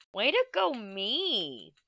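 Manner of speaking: self-cheer. Real, not acted. Congratulating herself.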